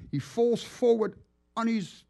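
normal talking voice

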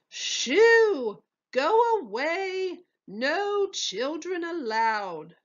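high, loud shoo, then slow; shooing shout. A narrator's reading. Head waiter shooing the twins away, high and loud. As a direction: chase the kids out, stretching the words.

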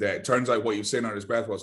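ordinary talk

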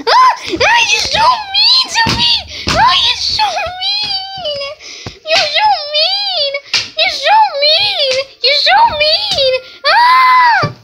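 hammed-up crying wail. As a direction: wail like a hurt crybaby. Insulted, fake crying wail.

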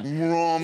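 drawn-out vroom sound effect; imitated FaceTime noise. Comic telling a host about a video call, imitated noise. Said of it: "you know that noise".

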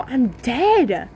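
ordinary talk

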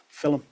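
"film" in two syllables, like "fillum"; "film" said Scouse-style as "fillum"; Scouse pronunciation of film. Shown as a demonstration. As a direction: slip a short vowel between l and m in "film". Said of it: "influences the Scouse dialect in the word".

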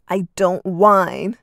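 loud, slow indignant huff. Acted. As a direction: deny it with a huff. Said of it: "Arden huffed".

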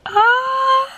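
high-pitched delighted "ah"; excited squeal. Real, not acted. Showing off her first car to viewers, excited squeal.

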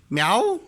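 short rising meow; cat meow imitation. Shown as a demonstration. Showing little Güneş how a cat cries, imitated meow. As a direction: meow like a cat, lifting at the end. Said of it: "Kedi nasıl bağırıyor kedi?".

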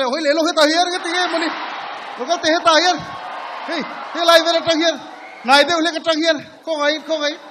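loud, high calling out; shouting. Real, not acted. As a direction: yell it across the hall. Calling out loudly to others.

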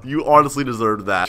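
usual speaking voice

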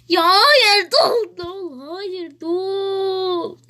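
usual speaking voice

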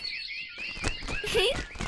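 very high, soft, tinkling giggle; a tiny bird's squeaky laugh. Acted. Sparrow giggling softly after being thanked.

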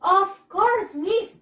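eager pupils answering their teacher. Acted. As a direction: chime in like the class answering the teacher.

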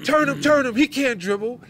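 high, urgent sideline coach shout; high-pitched mock yelling; yelling from the stands. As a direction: re-enact a parent hollering coaching at the court. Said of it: "I'm yelling down from the stands".

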